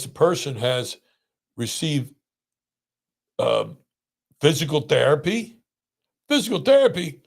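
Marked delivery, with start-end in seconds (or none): 6.3-7.2 indignant outburst. Real, not acted. Outraged rant about therapy rule.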